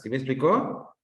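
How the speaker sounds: usual speaking voice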